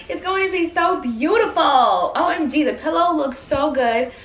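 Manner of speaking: giddy, laughing through the words; excited laughing. Real, not acted. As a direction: laugh with delight at it. Laughing excitement over pillow.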